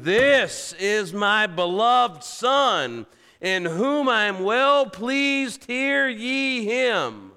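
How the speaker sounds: loud, high, drawn-out divine proclamation; booming heavenly voice. Retold or imitated. God announcing his beloved Son from the cloud, booming. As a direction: boom it out slowly as God from heaven. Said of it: "a booming voice from heaven".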